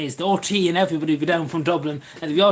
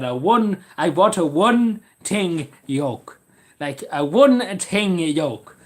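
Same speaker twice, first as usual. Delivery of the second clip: very slow, stretched-out words; acted customer request; 3 dB louder, much slower than usual. Customer in a shop, very slow mock request. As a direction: say it with exaggerated, spaced-out deliberateness. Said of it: "went to a shop and said".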